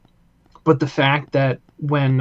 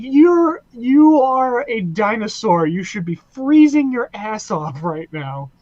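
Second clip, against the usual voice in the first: high-pitched incredulous rant at a cartoon dinosaur; exasperated disbelief, pitched way up, easing down; incredulous scolding; 7 semitones higher, 3 dB louder than usual. Giggling, mock-scolding the cartoon dinosaur.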